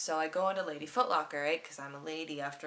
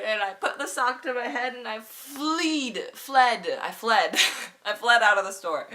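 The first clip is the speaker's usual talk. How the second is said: loud and higher, slow mock-dramatic telling; hammed-up shout; 6 semitones higher, 11 dB louder than usual. Narrator recounting fleeing the store, loud and hammed-up. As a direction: tell the escape like a tragedy. Comic flight story climax.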